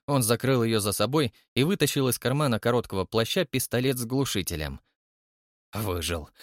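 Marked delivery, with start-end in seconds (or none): 5.7-6.3 wry smirk. Acted. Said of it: "усмехнулся Крымов".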